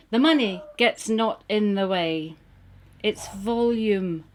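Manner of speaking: rhythmic, wry recital of verse; poem reading. Acted. Reading her clothing poem aloud, wry recital. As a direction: perform the rhymes with a knowing wink.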